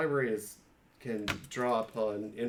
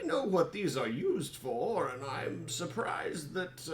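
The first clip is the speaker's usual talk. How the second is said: higher, slow in-character player voice; higher polite adventurer voice to the librarian; 5 semitones higher than usual. Acted.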